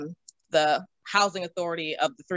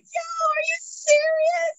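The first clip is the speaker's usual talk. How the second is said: much higher, slow, disbelieving squeal; freaking out; much slower than usual. Retold or imitated. Asking the realtor if they really got the house, high slow squeal. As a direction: stretch it out in giddy disbelief. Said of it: "freaking out".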